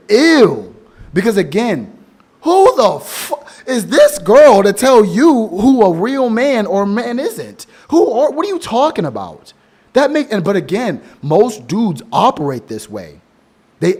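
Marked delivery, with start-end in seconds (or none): 0.1-0.7 disgusted ew. Retold or imitated. Reacting to guy lifting boxes. 2.4-9.5 much higher and louder, stretched out in disbelief; indignant outburst, high and loud; incredulous rant. Real, not acted. Incredulous rant about the girl.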